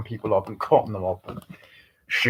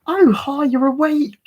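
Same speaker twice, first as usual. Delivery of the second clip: high-pitched, louder mock British greeting; British accent; 5 dB louder than usual. Co-host imitating an actress's greeting in horror scene, British accent. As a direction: put on a very high British lilt. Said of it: "she does a great British accent".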